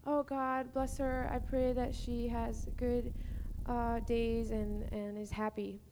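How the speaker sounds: soft, slow, half-hearted prayer; dutiful prayer. Shown as a demonstration. Teacher shows a prayer said without caring, softly and slowly. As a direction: pray politely, without much feeling. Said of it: "I can pray for her".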